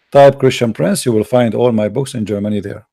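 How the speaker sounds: normal talking voice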